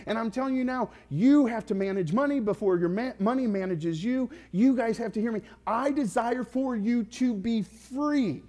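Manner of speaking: high-pitched, forceful appeal, key words punched; impassioned preaching. Real, not acted. Preacher urges congregation to be free of money's rule, impassioned preaching. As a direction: plead with the congregation at full pitch.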